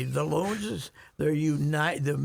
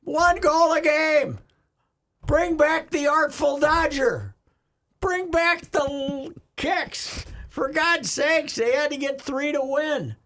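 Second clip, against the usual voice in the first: loud, fed-up sports rant; angry rant; 11 semitones higher, 5 dB louder than usual. Real, not acted. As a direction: shout your outrage at boring soccer. Rant about low-scoring soccer.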